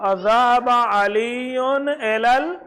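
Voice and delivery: slow, careful read of the Arabic sentence; slow reading aloud. Shown as a demonstration. Teacher reads the Arabic line slowly to students. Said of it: "तो क्या पूछा?".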